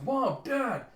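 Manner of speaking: high, loud acted cry; calling out in alarm. Nola calling to her parents in a nightmare, alarmed shout.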